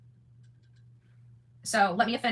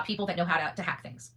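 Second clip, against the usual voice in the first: normal talking voice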